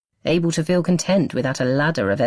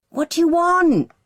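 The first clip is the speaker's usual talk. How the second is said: high, loud shout back; hollered; 5 dB louder than usual. A narrator's reading. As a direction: holler it from the bedroom. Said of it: "he hollers in response".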